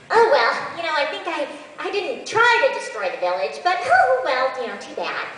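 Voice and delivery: high, airy, falsely innocent lady; high dismissive character line; Lena character voice. Shown as a demonstration. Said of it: "I start off with, uh, some Lena".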